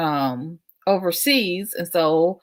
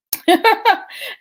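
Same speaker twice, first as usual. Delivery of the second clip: a bright laugh, pitched up; high laugh; 10 dB louder than usual. Real, not acted. Laughing at Mauritius as she signs off, high.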